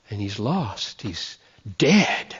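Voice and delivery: sudden quiet, grave ending; hushed verdict. Acted. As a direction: drop low and soft on lost and dead.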